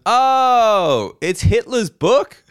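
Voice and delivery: high, slow dawning "oh"; clueless realization. Retold or imitated. Man in the second row realizes, high and slow. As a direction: play him as slowly catching on. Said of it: "just goes".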